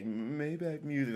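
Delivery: slow, breathy-free soft imitation of a girl's tag.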